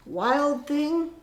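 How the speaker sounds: high, loud call of a scolding mother. Acted. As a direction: call him out loud like a cross mom. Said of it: "his mother called him".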